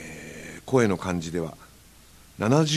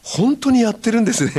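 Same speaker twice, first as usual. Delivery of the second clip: higher, louder surprised fan; 12 semitones higher, 5 dB louder than usual. Retold or imitated. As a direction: act out an excited young fan. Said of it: "って言われて". (驚いたファンの声)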